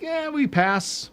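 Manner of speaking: high, slow, dragged-out mock shrug; so-so verdict. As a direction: imitate a planet that barely scrapes by. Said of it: "We're like".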